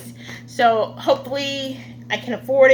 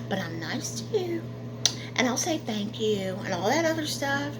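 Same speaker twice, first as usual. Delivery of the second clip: quiet mock aside; 9 dB quieter than usual.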